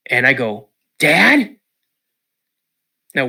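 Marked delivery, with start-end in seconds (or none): 1.0-1.6 high, loud scared call. Retold or imitated. Boy in deer stand calling his dad, high and loud.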